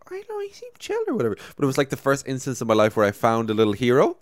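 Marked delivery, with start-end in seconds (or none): 0.1-1.4 put-on naive teen. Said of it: "so I'm just like".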